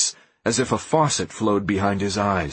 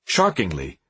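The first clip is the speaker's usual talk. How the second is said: plain reading voice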